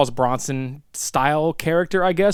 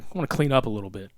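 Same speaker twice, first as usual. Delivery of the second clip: mumbled reply; 6 semitones lower, 7 dB quieter, much faster than usual. Retold or imitated. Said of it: "He's like".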